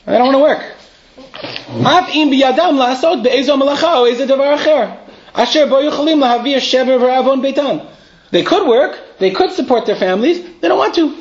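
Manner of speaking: rising outrage at the idle; indignant. Acted. Teacher to class, indignant at people who refuse to work.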